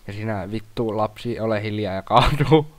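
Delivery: ordinary talk, nothing marked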